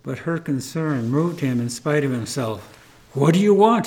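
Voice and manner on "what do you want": put-on loud gruff demand at the girl; growled. A narrator's reading. Bitter old man growls loudly at the kind girl. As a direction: snarl it at her to make her go away. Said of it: "he growled".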